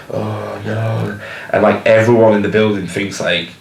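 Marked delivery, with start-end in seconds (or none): 0.1-1.2 soft, let-down moan of the crowd; mock audience moan. Copying the audience groaning when the band stops, soft mock moan. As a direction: sigh it out like a disappointed crowd. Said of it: "kind of moaning".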